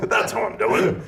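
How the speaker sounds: high, laughing mock yell. [name] redoing Rodney's punchline as a laughing yell. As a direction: belt it out while laughing. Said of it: "He yelled it".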